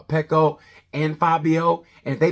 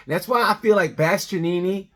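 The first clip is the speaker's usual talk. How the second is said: usual speaking voice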